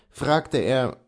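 ordinary delivery

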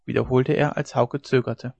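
ordinary delivery

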